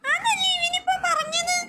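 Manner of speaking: high, fast, squeaky cartoon sidekick. Cartoon imp questioning the visitor, high and fast. Same character retorts.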